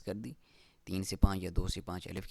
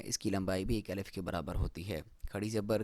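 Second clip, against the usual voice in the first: normal talking voice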